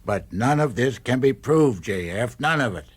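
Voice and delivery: forceful pushback, leaning on none; loud protest. Acted. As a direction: shout down the senator's argument. Adviser objecting in a heated argument.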